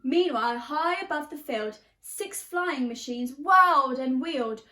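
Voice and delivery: lively storybook reading in rhyme; teacher reading a picture book, animated; reading to children. A narrator's reading. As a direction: bounce the verses for a class. Reads picture book aloud.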